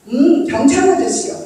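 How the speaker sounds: put-on a touch of a child's voice; small child's answer. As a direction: chirp it like a proud toddler.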